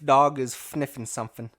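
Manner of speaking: "fniffing" said with an f sound in place of s; f for s lisp. Shown as a demonstration. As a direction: swap the s for f in "fniffing". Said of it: "you say the".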